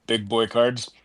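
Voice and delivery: usual speaking voice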